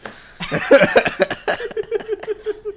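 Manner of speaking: squealing laugh; high shrieking laugh. Real, not acted. Laughing at his own joke.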